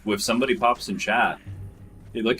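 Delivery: usual speaking voice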